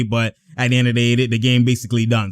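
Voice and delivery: normal talking voice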